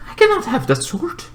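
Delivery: high, soft little voice; softly voiced character refusal; hushed refusal. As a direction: say it small, high and quiet. Acting out Bo-Katan refusing.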